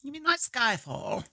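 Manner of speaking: lightly voiced old dowager; high, slow, a hint of an aged duchess. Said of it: "I can't turn the Dowager Duchess voice off now".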